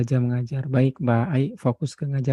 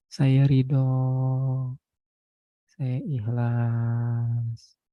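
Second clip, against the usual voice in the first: guided prayer; much slower than usual. Acted. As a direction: guide them gently into letting go. Leads surrender affirmations in prayer.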